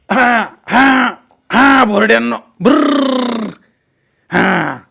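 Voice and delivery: high, loud hollers with a rolled trill; bird-scaring shouts. Pretend. Girl playing farmer scares birds, shouting. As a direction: drive the birds off with big drawn-out calls.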